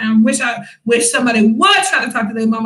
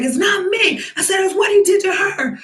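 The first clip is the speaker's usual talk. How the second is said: indignant retort, high; 7 semitones higher than usual. Retold or imitated. Arguing back at soldier.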